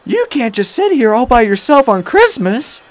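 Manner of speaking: high, loud woman's protest; shocked protest. A narrator's reading. Woman objects to Thomas being alone at Christmas, shocked.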